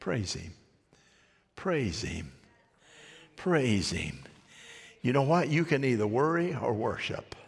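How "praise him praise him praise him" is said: lower, softer repeated praise; softly repeating the praise; hushed praise. Real, not acted. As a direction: let it fall to a hush.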